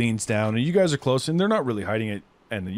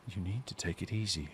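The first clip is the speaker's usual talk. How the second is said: low and much softer, calm threat; soft warning; 3 semitones lower, 12 dB quieter than usual. Acted. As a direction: quietly tell him to calm down. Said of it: "She goes".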